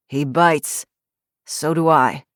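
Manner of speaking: put-on hoarse, grumpy old ex-cop; gruff old man. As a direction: growl it like a surly old man. Grumpy retired cop threatens.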